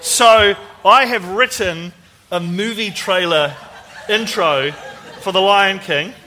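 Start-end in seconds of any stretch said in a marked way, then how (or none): none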